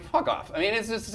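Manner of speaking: usual speaking voice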